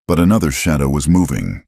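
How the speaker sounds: plain reading voice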